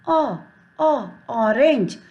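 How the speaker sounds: "o" alone, then "orange" slowly from that sound; phonics O sound. Shown as a demonstration. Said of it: "we will pronounce". ("o, orange" धीरे, पहली ध्वनि दिखाते हुए)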